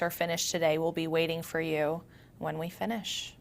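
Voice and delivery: soft meditation voice with long pauses; guided meditation. Acted. Yoga teacher grounding listeners through their senses, guided meditation. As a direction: guide them gently inward, leaving space between phrases.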